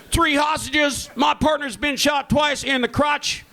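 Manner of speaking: high, shouted cop bravado; over-the-top American cop. Retold or imitated. American reality TV cop, shouting with bravado. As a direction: yell it like a TV cop hyping a bust. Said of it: "It'd be like".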